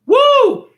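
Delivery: high, loud excited whoop; high hype whoop. Acted. Hyped exclamation after story beat.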